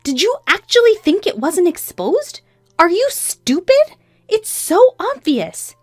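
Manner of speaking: high, loud, drawn-out disbelief; scornful anger. A narrator's reading. Wife exposing her husband's affair, scornful. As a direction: lay into them, stretching the words.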